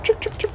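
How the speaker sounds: high, breathless little chirps like a bird. Retold or imitated. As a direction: copy the birds' chirp, tiny and high. Imitates birds chirping in the background.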